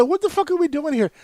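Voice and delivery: high, exasperated demand; exasperated outburst. Retold or imitated. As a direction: at the end of his rope. Exasperated at repeated wrong answers.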